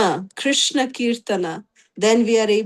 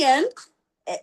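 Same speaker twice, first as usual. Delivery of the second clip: ordinary talk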